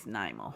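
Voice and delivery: "Nimo" said NIGH-moh; first syllable of "Nimo" like "nigh"; long-i pronunciation. Shown as a demonstration. Crafter showing how it is pronounced, long-i pronunciation. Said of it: "So pronounce it like".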